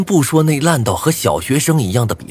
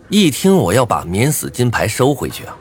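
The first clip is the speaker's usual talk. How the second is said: ordinary delivery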